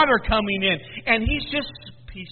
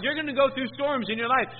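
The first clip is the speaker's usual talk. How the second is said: ordinary delivery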